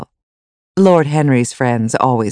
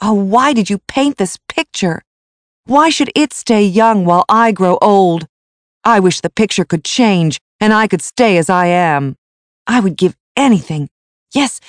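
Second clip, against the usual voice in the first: higher, anguished protest; angry outburst; 6 semitones higher, 3 dB louder than usual. A narrator's reading. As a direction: protest with mounting desperation. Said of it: "his face red and angry".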